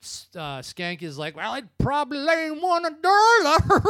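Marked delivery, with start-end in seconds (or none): 1.3-3.8 high, loud, weird wacky voice; weird crazy voice. Retold or imitated. Retelling the movie, imitating Skank's weird crazy voice. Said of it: "He had that weird, crazy kind of voice".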